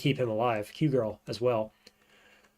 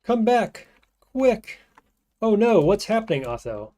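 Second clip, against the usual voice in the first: mock panic; 8 semitones higher, 4 dB louder than usual. Voicing panicked ally in game.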